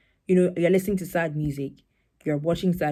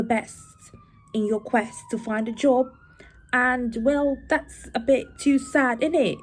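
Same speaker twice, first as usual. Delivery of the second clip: put-on light British accent, pitched up; 7 semitones higher than usual. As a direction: put on a playful British lilt. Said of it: "I don't know why I have switched to this".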